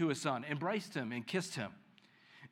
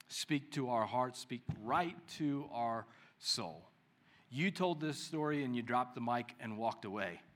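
soft, low, unhurried prayer voice; 3 semitones lower, 3 dB quieter than usual. Real, not acted. Pastor praying quietly with the congregation after the story. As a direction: pray intimately, almost hushed.

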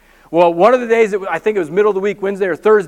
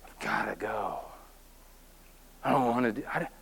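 much softer, breathy and unwilling; reluctant whisper; 10 semitones lower, 16 dB quieter than usual. Retold or imitated. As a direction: whisper it like you dread going. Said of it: "we're just like".

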